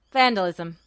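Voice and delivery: low curt military voice; curt escort. Acted. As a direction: shut the topic down.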